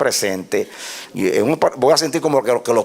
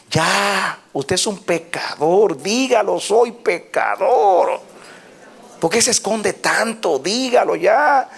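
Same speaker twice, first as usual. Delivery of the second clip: preaching at full force; 8 semitones higher, much slower than usual. Real, not acted. Preacher urging sinners to confess, fiery high and slow. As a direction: drive the congregation to confess.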